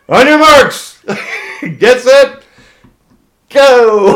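laughing mock race-start shout. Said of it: "turns around and goes".

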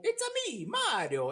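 high, Italian video game plumber voice; Italian Mario accent. Retold or imitated. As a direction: do the classic Mario line. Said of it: "ele não vai falar, ele vai falar".

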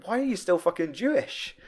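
high mock incredulous questions; imitating the guys baffled by Walter; friends baffled. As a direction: fire questions in disbelief. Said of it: "like, saying".

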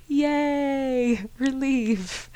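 mock celebration. Real, not acted. Podcaster celebrating her period's arrival, giddy relieved cheer.

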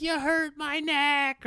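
dad imitates his crying son, slow and high; crying kid. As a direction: whimper it out like a hurt little boy. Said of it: "the kid's crying".

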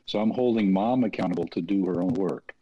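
ordinary talk, nothing marked

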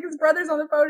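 ordinary talk

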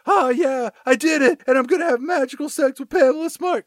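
high-pitched goofy teen impression; mock dopey boy bragging up high; Billy Flynn. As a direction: put on a silly high voice, boasting. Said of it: "that's how he talks".

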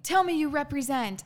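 loud, high mock command; imitating his demand. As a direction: bark his order back, loud and high. Retold demand from abusive partner.

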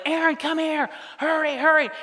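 high, urgent imitation of a wife calling; wife yelling. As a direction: call him over with mock urgency. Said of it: "my wife yells at me".

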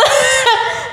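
very high, loud laugh burst. Real, not acted. Laughing at the question.

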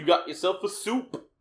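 loud high cooking host voice; chef voice. Retold or imitated. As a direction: hype it like a chef. Joking cooking bit echoed.

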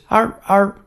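two high little yaps; small imitated bark. Describing the neighbour's dog, small high barks. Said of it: "it's just no big bark".

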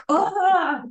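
sudden high loud "Ah!" cry; release cry. Shown as a demonstration. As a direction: let the tension burst out in one yelp. Natural body response, release cry.

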